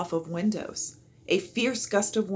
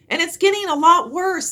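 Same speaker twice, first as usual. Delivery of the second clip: shouting back; 12 semitones higher, 11 dB louder than usual. A narrator's reading. Shouting over the storm.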